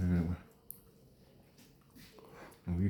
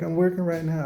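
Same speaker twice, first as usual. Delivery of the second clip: higher, mock woman's voice; 8 semitones higher than usual. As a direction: lift your voice for the waitress. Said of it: "She's like".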